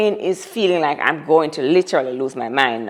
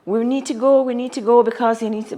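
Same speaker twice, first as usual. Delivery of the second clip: annoyed nurse; 6 semitones higher than usual. Retold or imitated. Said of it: "she starts to get annoyed now".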